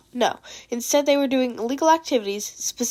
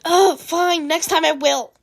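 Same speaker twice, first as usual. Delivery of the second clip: loud, higher huffy surrender; grudging give-in; 5 semitones higher, 4 dB louder, slower than usual. Acted. Mei conceding the chair to Monoma, huffy and high. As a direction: sigh it out, annoyed.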